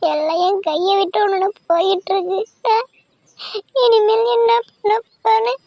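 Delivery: breaking down in tears to her husband; tearful despair. Acted. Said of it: "சின்ன பிள்ளைத்தனமா அழுதுட்டு இருக்க". (அழுகையுடன் உடைந்து பேசுதல்)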